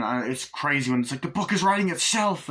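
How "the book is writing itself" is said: shocked discovery. Retold or imitated. Kids discover the book.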